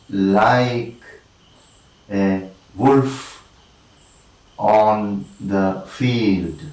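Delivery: very slow, a long pause after each beat; word-by-word scansion. Shown as a demonstration. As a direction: dole out the verse word by word. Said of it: "two unstressed followed by one stressed".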